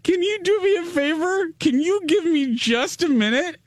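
high, strained squeak of embarrassment; mortified plea. Retold or imitated. Embarrassed client begging the massage therapist for a minute, mortified squeaky plea. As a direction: plead in a small, very high voice.

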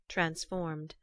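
usual speaking voice

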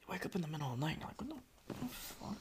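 fast hushed whisper. Retold or imitated. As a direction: whisper like waking at night. Waking confused in the night.

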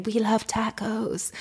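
softly imitating sneaky whispers, drawn out; imitated whispering. Runners teasing as she walked past, slow mock whisper. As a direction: say it slow and hushed, like teasing behind her back. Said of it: "runners and stuff were whispering".

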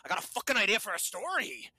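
loud, swaggering Scottish pitch; mock Scottish accent. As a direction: bark it in a hammed-up Scottish accent. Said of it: "he goes".

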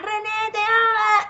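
high squealing fan cry of the name; screaming fans. Retold or imitated. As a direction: squeal it like a fangirl at a concert. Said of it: "había un montón de chicas y gritaban".

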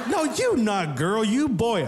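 lightly voiced mom with a Vietnamese accent, slow; mock Vietnamese mom. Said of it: "She goes".

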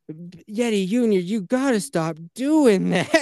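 put-on high, slow little-girl scolding; a toddler gravely copying a parent's warning; daughter scolding brother. Daughter scolding baby brother in tub, slow high child voice. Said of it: "She starts being like".

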